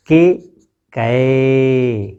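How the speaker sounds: के then कै held open; कै spoken long and open from throat and palate; e and ai demo. Shown as a demonstration. Teacher contrasts the vowels for pupils, slow demo. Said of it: "कंठ और तालु".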